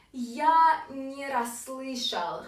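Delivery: very slow, clearly separated words; slow model phrase. Shown as a demonstration. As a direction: stretch it out for learners to repeat. Slow final review for learners.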